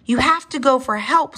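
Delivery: high, urgent plea; urging. A narrator's reading. Stuck mother dinosaur sending her child off, urging.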